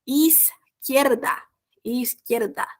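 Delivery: "Izquierda" drawn out slowly; teacher's slow, stretched-out "Izquierda"; slow word demonstration. Shown as a demonstration. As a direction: model "Izquierda" slowly for learners. Teacher models the new word slowly.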